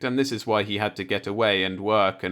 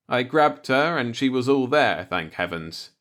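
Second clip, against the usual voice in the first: ordinary delivery, nothing marked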